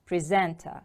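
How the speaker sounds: "Presenter" with the stress on SENT; second-syllable stress. Shown as a demonstration. Teacher models the word for repetition, "Presenter" stressed on SENT. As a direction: lean on the middle of "Presenter": pre-SENT-er. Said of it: "The stress is on the second syllable".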